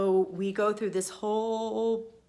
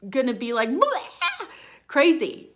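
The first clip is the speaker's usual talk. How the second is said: laughing, higher and louder, a silly unhinged squeal; hammed-up going crazy, half laughing; mock crazy woman; 5 semitones higher, 6 dB louder than usual. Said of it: "I'll turn into a crazy woman".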